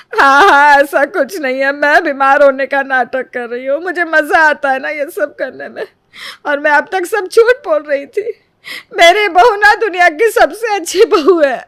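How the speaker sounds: loud, high wailing sarcasm; fake crying. Mother-in-law stages a loud fake cry before the neighbour. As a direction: sob it out as a show. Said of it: "रो रो कर तमाशा खड़ा कर देती है".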